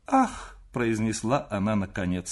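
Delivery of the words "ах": high anguished "ah"; тоска. A narrator's reading. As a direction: let out a pained, high-pitched exclamation. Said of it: "с тоской". (тоскливое высокое «ах»)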